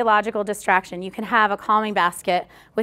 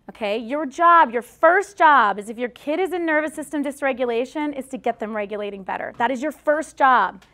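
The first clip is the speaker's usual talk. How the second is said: ordinary talk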